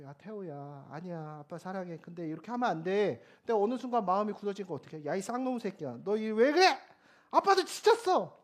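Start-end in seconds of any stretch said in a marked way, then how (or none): 0.0-3.2 gentle coaxing. Retold or imitated. Father soothing his fussing child, gently coaxing. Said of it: "처음엔 좋게 달래려고 하죠". 5.1-8.4 retold fed-up parent blowing up; angry outburst. Exhausted father losing his temper at his child, angry outburst. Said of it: "막 화를 버럭 내는 거죠".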